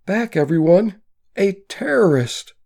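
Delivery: high, loud panicked scream. A narrator's reading. A woman warns the crowd of a gunman, screaming. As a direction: shriek a warning in terror. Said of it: "screams a woman's voice".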